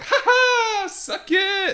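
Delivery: high gloating cackle, then suck it; mocking laugh. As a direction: gloat like a sore winner, laughing. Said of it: "And I was like".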